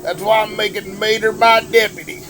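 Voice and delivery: terrible Larry the Cable Guy. Acted. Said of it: "that was me doing a terrible Larry the Cable Guy impersonation".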